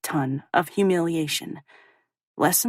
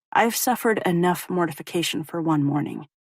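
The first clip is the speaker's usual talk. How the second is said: usual speaking voice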